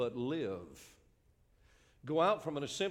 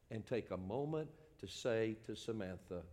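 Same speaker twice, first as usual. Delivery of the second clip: ordinary talk, nothing marked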